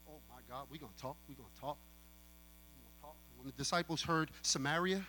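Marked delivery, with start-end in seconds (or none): 0.1-3.2 much softer, fast under-breath "we gonna talk"; muttered aside. Real, not acted. Preacher mumbling an aside to himself, very soft. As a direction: mutter it to yourself.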